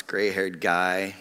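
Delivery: ordinary talk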